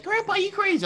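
high mock grandkid voice, teasing grandpa; grandchild voice. Kid mocking grandpa, in a high, teasing voice. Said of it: "They can be like".

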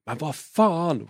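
retold outburst of annoyance, drawn out; instantly pissed off. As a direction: imitate a sour driver swearing. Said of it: "skitsur direkt".

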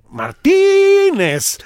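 Martínez con la í larguísima, aguda y fuerte; acento exagerado. Acted. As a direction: alarga y dispara la í de Martínez. Caricaturiza el acierto del acento. (Martínez con la í exageradamente alargada)

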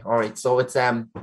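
usual speaking voice

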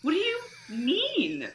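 scoffing at a clingy suitor; incredulous retort. Retold or imitated. Mocking the Frenchman's clinginess, high and hushed. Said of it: "and I'm like".

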